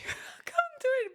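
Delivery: high, laughing give-up. Real, not acted. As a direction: laugh as you admit it. Failing to whistle, laughing.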